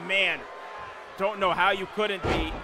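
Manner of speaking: ordinary talk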